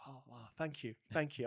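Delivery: soft imitation of modest gratitude; humble thanks. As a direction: thank them quietly, as a humble man would. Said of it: "he's, like, very humble".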